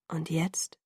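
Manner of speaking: whisper. A narrator's reading. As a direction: whisper it right against her skin. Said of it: "flüsterte er".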